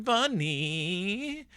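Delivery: lightly voiced baby pleading; toddler voice. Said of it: "even Honey is like".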